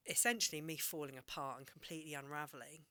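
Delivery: usual speaking voice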